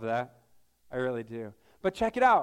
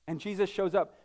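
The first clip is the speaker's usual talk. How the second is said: normal talking voice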